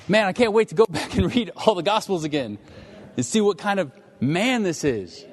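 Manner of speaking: high-pitched, excited gushing; eager enthusiasm. Acted. Preacher voicing listeners keen to reread the Gospels, excited. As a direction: burst out as if you can hardly wait. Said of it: "I hope you're like".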